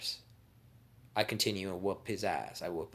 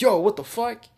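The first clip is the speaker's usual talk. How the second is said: high, loud yelp of alarm; startled outcry; 13 semitones higher, 14 dB louder than usual. Acted. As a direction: cry out as if caught off guard. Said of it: "He's like".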